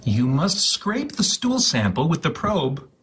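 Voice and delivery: usual speaking voice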